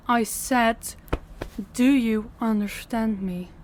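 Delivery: slow, firm repeat of the question; slow, insistent mistress demanding obedience; stern demand. Acted. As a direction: demand an answer, slowly and deliberately.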